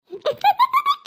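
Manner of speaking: high laughing squeal of glee; gleeful laughing shriek. Acted. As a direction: shriek with laughter at their pain. Child laughs at punished siblings.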